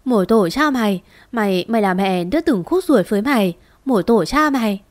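plain reading voice

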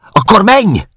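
hirtelen, magas, hangos felkiáltás; kiabáld rá dühösen a férfira; kiáltás. A narrator's reading. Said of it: "Kiáltott fel hirtelen". (felcsattanó, kétségbeesett kiáltás)

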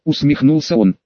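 ordinary delivery

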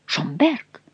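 high, hushed exclamation; startled call, kept under the breath; astonished exclamation. A narrator's reading. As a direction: breathe the name in disbelief. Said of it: "воскликнул король".

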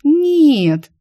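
high, loud, drawn-out NO. A narrator's reading. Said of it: "протянула Глория". (протяжно, высоко и громко)

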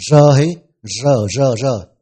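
rờ called out loud and clear, repeated; emphatic rờ drill. Shown as a demonstration. Teacher drills the rờ sound loudly for students. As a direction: punch rờ for the class to copy. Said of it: "sẽ đọc là rờ".

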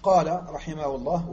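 ordinary talk

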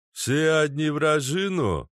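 slow, mangled yokel guard voice; mangled words. Acted. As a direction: slur the words like a thick guard. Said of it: "сильно коверкая слова".

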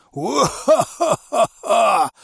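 высокий, медленный злодейский хохот; хохочи как киношный злодей; злодейский смех. Acted. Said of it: "изобразил я злодейский смех". (нарочито злодейский смех)

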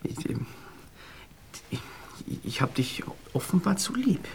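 leise, tonlos, verlegen; flüstert beschämt; geflüstert. Acted. Verlegene Entschuldigung nach Versagen. (verlegenes Flüstern)

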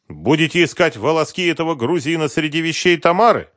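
loud, high-pitched bitter jibe; mirthless joke. A narrator's reading. Husband needling the expert about his wife's lover, mirthless. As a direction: throw out a sour joke. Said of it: "невесело пошутил Сергей Викторович".

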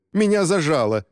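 high, pleading complaint; pained moan. A narrator's reading. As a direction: cry out trapped, in an acted wail. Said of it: "простонал Рубин". (жалобный стон, повыше)

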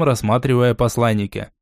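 plain reading voice

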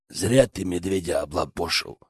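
low, soft gloating voice; grinning villain. A narrator's reading. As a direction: taunt through a nasty grin. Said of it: "осклабился череп".

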